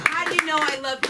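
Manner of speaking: high-pitched gushing thanks; delighted. Real, not acted. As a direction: gush over an unexpected gift in a high voice. Gushing over a surprise gift.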